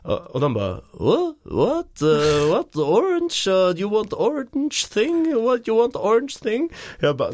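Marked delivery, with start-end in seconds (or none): 0.9-5.4 high, baffled imitation of shop staff in broken English; confused foreign shopkeepers. Traveller imitating shop staff puzzled by a request for oranges, high and baffled. 5.4-6.7 high, baffled imitation of shop staff in broken English; confused foreign shopkeepers. Traveller imitating shop staff puzzled by a request for oranges, high and baffled.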